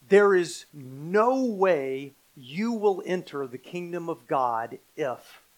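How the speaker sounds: slow, stretched stress on "no way"; very emphatic. Acted. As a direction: declare it slowly, leaning hard on "no way". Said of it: "is a very emphatic statement".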